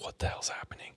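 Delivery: whispered, mock-bewildered aside; retold freaked-out whisper. Freaked-out tech wondering to himself, in a retold whisper. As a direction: whisper it like a hidden thought.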